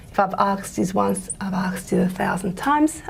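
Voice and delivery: "asked" said as "axed", k before s; metathesised "asked". Shown as a demonstration. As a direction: flip the consonants so "asked" comes out "akst". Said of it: "some people say, instead of ask".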